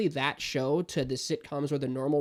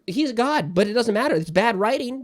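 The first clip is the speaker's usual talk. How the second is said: higher, louder heated rant; impassioned rant; 5 semitones higher, 7 dB louder than usual. Real, not acted. Fan ranting about bad TV writing, heated and loud. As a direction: lecture the writers with heat. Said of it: "my rant".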